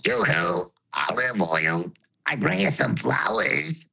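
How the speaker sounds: high and low at once; Popeye throat voice. Shown as a demonstration. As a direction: sing it like a Tuvan throat singer. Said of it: "a high voice and a low voice at the same time".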